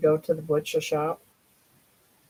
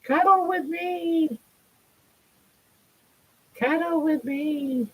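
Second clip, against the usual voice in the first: high, slow voicing of a cuddly cat; pet's voice; 8 semitones higher, 4 dB quieter, slower than usual. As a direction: plead for cuddles in a squeaky little pet voice. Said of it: "they come out of the woodwork".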